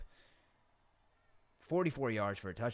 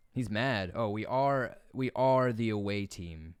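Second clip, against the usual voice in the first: ordinary talk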